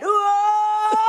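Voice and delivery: high, loud whoa into laughter; mock surprised whoa. Friends watching her trip, loud high surprised whoa. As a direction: gasp loudly at her tripping, then laugh.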